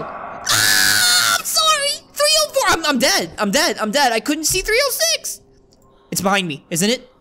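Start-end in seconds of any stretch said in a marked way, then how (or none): 0.0-5.4 high frantic yelps of panic; screaming in real fright; panicked yelling. Real, not acted. Caught by the monster.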